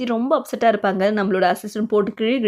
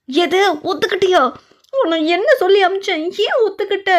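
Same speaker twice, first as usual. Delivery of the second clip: furious disbelief; 8 semitones higher than usual. Acted. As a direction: blast her for agreeing against orders. Stepmother learns the mother agreed. (கோபமான அதிர்ச்சி)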